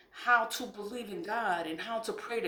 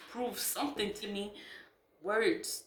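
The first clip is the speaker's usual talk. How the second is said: ordinary talk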